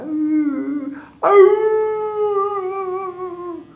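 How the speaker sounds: high whimpering whine; dog whine. Acted. Dogs tied up crying, high whimper. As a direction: whine like a sad hound.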